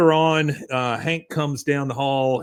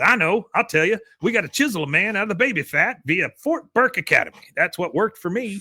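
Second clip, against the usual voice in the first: put-on higher, boastful old man; gruff grandpa; 7 semitones higher than usual. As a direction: lay it down like a stern veteran. Cotton proposes military academy.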